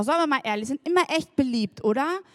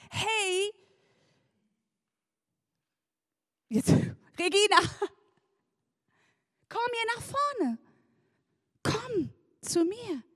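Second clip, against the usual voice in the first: high, soft beckoning call; gently calling out; 6 semitones higher, 3 dB quieter than usual. Acted. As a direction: draw the words out, lightly coaxing someone over. Voicing Jesus calling her forward.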